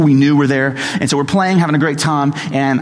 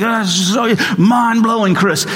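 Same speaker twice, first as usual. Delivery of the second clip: gushing jazz guys; 6 semitones higher than usual. Retold or imitated. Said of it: "We're like".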